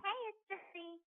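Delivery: faint recorded name over a phone line; payphone call. Real, not acted. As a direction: say it into a distant prison phone.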